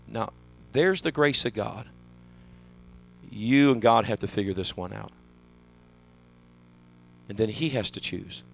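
low, soft pastor's appeal; hushed appeal. Real, not acted. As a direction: pull the voice right down, almost private. Preacher hushes at altar call.

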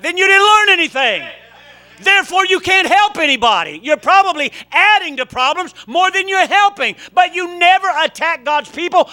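loud, high preaching voice; fiery preaching. Real, not acted. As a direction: preach it hard to the congregation. Preaching at full force.

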